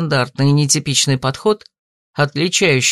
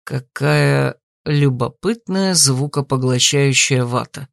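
slow, lower, politely amused; 7 semitones lower, slower than usual. A narrator's reading. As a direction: say it slowly, politely restraining a laugh. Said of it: "вежливо сказал он".

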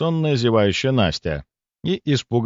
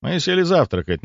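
usual speaking voice

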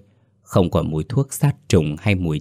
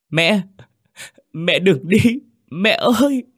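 much higher, loud and rising cry after her; desperate call; 12 semitones higher, 4 dB louder than usual. A narrator's reading. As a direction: cry out as she fades into the light. Son calls after vanishing mother. (gào gọi mẹ trong tuyệt vọng)

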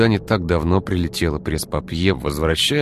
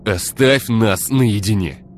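held-back anger; 5 semitones higher, 5 dB louder, slower than usual. Acted. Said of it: "пытался сдерживать эмоции".

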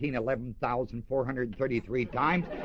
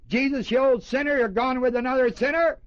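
mock open accusation; 12 semitones higher, 4 dB louder than usual. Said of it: "They didn't come right out and say".

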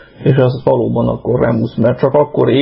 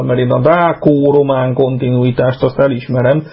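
ordinary delivery

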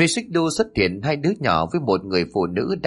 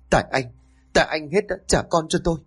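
high-pitched accusing outburst; angry blame; 6 semitones higher, faster than usual. A narrator's reading. Bereaved wife pounds husband's chest, angrily blaming him.

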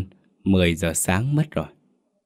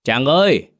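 higher, firmer call to wake someone; raised voice calling a sleeper; louder call; 6 semitones higher, 4 dB louder than usual. A narrator's reading. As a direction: call out a little more insistently. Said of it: "với mức âm lượng to hơn đôi chút".

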